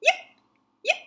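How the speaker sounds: a loud little yapping puppy; tiny dog voice. Acted. Puppy in the acorn yapping at the prince's ear. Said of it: "a tiny little voice going".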